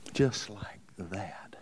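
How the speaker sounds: slow, low breathy whisper; hushed slow whisper for effect. Real, not acted. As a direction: drop to a whisper and draw it out. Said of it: "repeated for effect, whispered".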